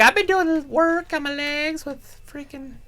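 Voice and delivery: put-on high falsetto girl voice. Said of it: "She's like".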